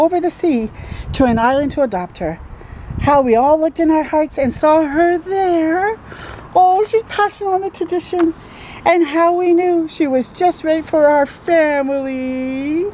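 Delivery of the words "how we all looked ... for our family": tender storytelling. A narrator's reading. Reading to children, slow and tender.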